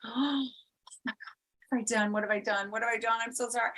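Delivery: high, panicked gasp and rush of apology; gasp, then blurt it out in a panic. Retold or imitated. Retelling losing the diamond, panicked. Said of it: "I was just like".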